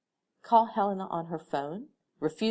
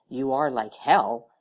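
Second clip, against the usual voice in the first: louder, slow blurt of disbelief; sudden outburst, slowly; 2 semitones lower, 9 dB louder, slower than usual. A narrator's reading. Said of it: "blurted out".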